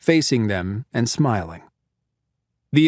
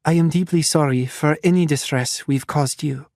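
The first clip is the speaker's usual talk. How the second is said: lightly voiced refined French accent, a touch higher; polite doctor with a hint of a French lilt; slight French accent; 3 semitones higher than usual. Doctor apologizing to the captives, slight refined French accent. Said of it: "with a refined-sounding, slightly French accent".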